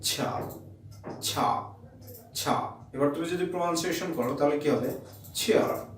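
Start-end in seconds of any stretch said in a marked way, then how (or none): 0.0-2.7 low soft ছা with a slow release; affricate demo. Shown as a demonstration. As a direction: ease the air out on each ছা. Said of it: "যেমন কি". (নিচু স্বরে আস্তে ছা)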